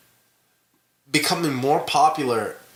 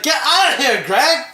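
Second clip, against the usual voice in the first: higher voice, rising on Greg; Wiseau imitation; 10 semitones higher, 3 dB louder than usual. Friend mimicking Tommy shooing Greg away, hammed-up. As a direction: fling it out in mock Tommy voice. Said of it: "No, he's like".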